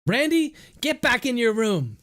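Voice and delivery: high, loud command up the stairs; sudden shout. A narrator's reading. Mother shouting her son back to his room, loud and high. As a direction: bark it up at the boy. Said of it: "Miranda suddenly shouted".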